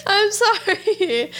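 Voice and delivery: slow apology through laughter; laughing apology. Real, not acted. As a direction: giggle out a slow sorry. Laughing after the vodka shot.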